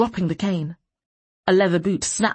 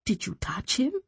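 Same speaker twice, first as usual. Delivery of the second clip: tense; 5 dB quieter than usual. A narrator's reading. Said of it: "Suddenly she tensed".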